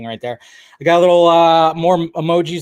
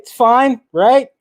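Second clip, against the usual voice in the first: high, louder mock reassurance; defensive self-quote; 8 semitones higher, 7 dB louder, slower than usual. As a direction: retell your own excuse, pitched up and insistent. Retelling himself excusing his lateness.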